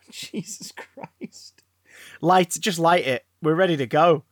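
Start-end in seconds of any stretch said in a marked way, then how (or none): 0.0-1.6 breathless laughing whisper. Real, not acted. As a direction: laugh it out under your breath. Whispered laugh after the joke.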